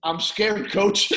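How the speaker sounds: scared player. Retold or imitated. Player confessing to his screaming coach, mock scared voice. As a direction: plead it up high to the coach, playing it for laughs. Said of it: "Johnny looks and goes".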